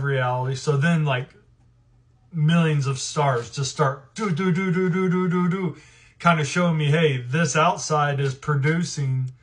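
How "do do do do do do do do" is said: higher-pitched "do-do-do" sound effect; quick string of identical doos, like dots streaming in; rapid imitated noise. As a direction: mouth a rapid little run of doos.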